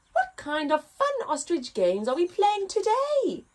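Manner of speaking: hopeful little giraffe asking to join. Acted. Giraffe begging the ostriches to play, hopeful. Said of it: "he asked the ostriches hopefully".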